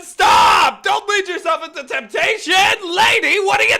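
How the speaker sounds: loud, high scolding yell; exasperated shout. Real, not acted. Streamer scolds a game character, exasperated shout. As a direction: yell at her to stop.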